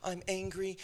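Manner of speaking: ordinary delivery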